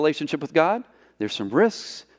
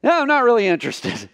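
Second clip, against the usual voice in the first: higher, dismissive brush-off; girl turning down; 7 semitones higher than usual. Retold or imitated. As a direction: imitate her polite rejection, lightly. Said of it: "she was gonna go".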